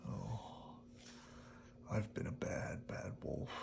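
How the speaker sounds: soft, low, whispered regret; remorseful wolf. Acted. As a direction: sigh it, ashamed of yourself. Wolf regrets his tantrum.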